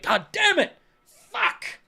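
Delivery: high, strained yell of traffic fury; mock road rage yell. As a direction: blow up like you're stuck in traffic. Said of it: "I'm like".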